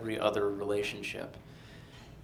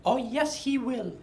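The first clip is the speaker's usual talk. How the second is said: high confident French teacher; French accent; 11 semitones higher than usual. Retold or imitated. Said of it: "she spoke like this".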